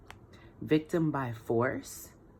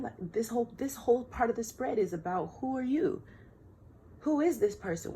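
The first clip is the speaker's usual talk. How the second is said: excited; 10 semitones higher than usual. Real, not acted. Emphatic high rising question.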